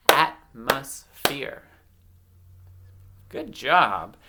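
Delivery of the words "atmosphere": "Atmosphere" slow and loud as at, mos, phere; syllable by syllable. Shown as a demonstration. Teacher giving children a word to blend themselves, syllable by syllable. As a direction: break "Atmosphere" apart at each syllable. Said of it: "I'm gonna clap out".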